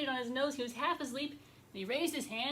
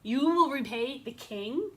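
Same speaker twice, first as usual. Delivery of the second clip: higher, louder disbelieving question; amused incredulous king; 3 semitones higher, 7 dB louder than usual. Acted. King laughing at the bee's promise, incredulous. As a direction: scoff with a big rise at the end. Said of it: "He laughed so much".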